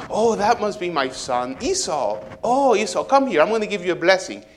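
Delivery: hammed-up overjoyed old dad; delighted father. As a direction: greet your favourite son with glee. Father happily recognizes his son.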